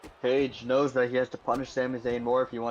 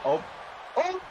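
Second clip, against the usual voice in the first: excited call; 12 semitones higher than usual. Real, not acted. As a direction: call the move at full pitch. Calling the move live.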